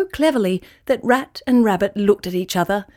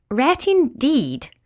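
slow, higher-pitched indignant snort; haughty rabbit's scoff; 5 semitones higher, much slower than usual. A narrator's reading. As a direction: draw it out in offended scorn. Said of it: "it snorted".

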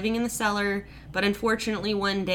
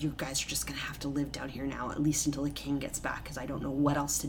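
hushed unvoiced whisper, secretive; 7 semitones lower, 11 dB quieter, faster than usual. King hiding his family tells them in a whisper. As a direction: whisper it so nobody upstairs hears.

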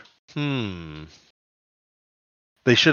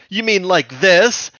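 cocky retort; 8 semitones higher, 5 dB louder, slower than usual. Retold or imitated. Cheetor quoted.

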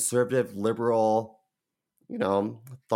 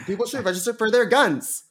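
mocking guest voice; 9 semitones higher than usual. Guest quoted, far high voice.

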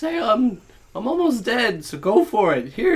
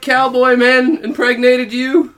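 loud taunt; 11 semitones higher, 13 dB louder than usual. Acted. Character taunts loudly.

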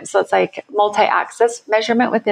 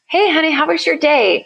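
cheery greeting; 7 semitones higher than usual. Retold or imitated. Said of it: "you're like".

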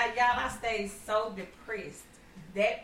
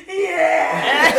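laughing loudly through it; 7 semitones higher, 16 dB louder than usual. Real, not acted. Telling family the story, laughing out loud. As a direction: burst out laughing at the memory.

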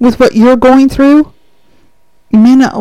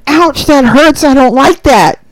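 complaining clay; 3 semitones higher, 5 dB louder than usual. Acted. Clay voiced on potter's wheel.

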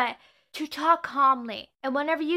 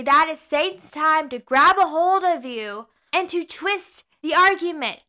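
ordinary talk

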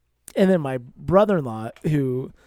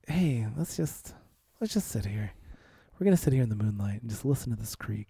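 soft romantic suggestion; 6 semitones lower, 9 dB quieter than usual. Retold or imitated. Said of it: "I was like".

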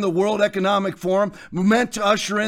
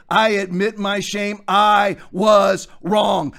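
shouted, drawn-out admission of wrong; mock confession; slower than usual. Preacher shouting what a repentant leader should confess. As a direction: cry it out like a penitent owning his shame.

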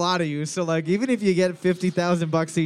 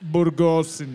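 hammed-up mock Spanish accent; put-on Spanish accent; 3 semitones lower than usual. Shown as a demonstration. Quiz host giving a hint to players, hammed-up Spanish accent. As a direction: ham up a Spanish pronunciation. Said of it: "I would probably say with an accent".

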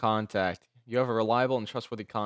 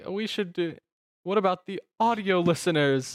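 high mocking impression; mocking whiny voice; 8 semitones higher than usual. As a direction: whine it to mock her. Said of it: "you're like".

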